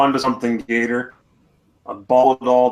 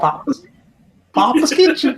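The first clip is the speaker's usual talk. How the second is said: very high squeaky toy voice; high doll voice. Pretend. Continuing the doll voice game.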